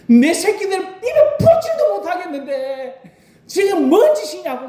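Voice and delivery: high, strained furious wail; desperate angry outcry. Shown as a demonstration. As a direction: cry out for your starving children in rage. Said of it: "죽을 듯이 이야기합니다". (자식 굶기는 부모의 분노 섞인 절규)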